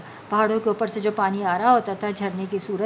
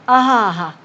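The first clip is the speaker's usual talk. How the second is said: louder gleeful aha of a hungry wolf; 7 dB louder than usual. Acted. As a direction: gloat like a wolf who has found his dinner. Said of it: "بھیڑیے نے دل میں کہا". (خوش بھیڑیے کی بلند آہا)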